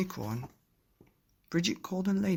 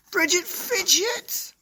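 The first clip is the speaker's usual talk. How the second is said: much higher, louder, slow drawn-out shout; angry dad yell; 9 dB louder, slower than usual. Acted. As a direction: yell her full name like a cross dad. Daddy yelling about the snow.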